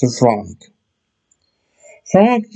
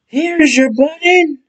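much higher eager helper voice; Frog's voice. Acted. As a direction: hold it out high and hopeful. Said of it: "said Frog".

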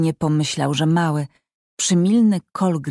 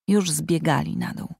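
plain reading voice

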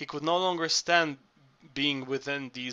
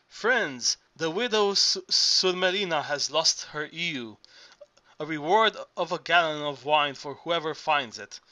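ordinary delivery

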